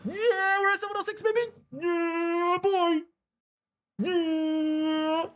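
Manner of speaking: a real joyful cheer; elated whoop. Real, not acted. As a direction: whoop like you just won. Celebrating the price jump.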